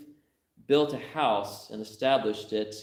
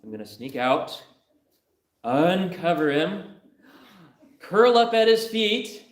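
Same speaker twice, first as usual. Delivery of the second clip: higher and higher, building to a loud squealing laugh; daughter's plan building; 9 semitones higher, 5 dB louder than usual. Acted. Daughter acting out her proposal, rising to a loud giggly squeal. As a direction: build from eager to a shrieking, giggling climax. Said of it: "He said".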